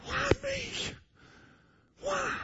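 high, soft, thin plea; hurt child asking why, soft and high; small whimper. Retold or imitated. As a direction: ask it in a tiny lost voice. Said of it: "You thought".

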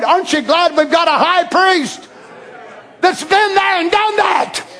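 fiery preacher's climax; full-force preaching. Acted.

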